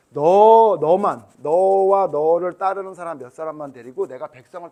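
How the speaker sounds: loud, commanding voice of God; angry God. Acted. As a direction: declare it as a furious God. Voicing God's angry decree. (크고 엄하게 하나님 목소리로)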